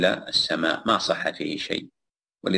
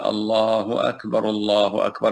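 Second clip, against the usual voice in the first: joined takbirs; 2 semitones higher than usual. Shown as a demonstration. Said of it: "وإذا قال".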